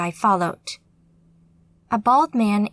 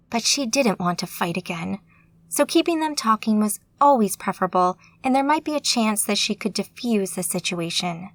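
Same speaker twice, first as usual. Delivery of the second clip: ordinary delivery, nothing marked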